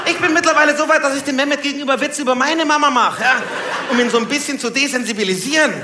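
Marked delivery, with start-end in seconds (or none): none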